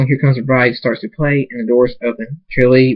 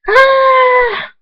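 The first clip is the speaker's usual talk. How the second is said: very high, sustained shriek; piercing drawn-out birth scream; labor scream. A narrator's reading. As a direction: scream through a contraction. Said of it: "Fluttershy screams".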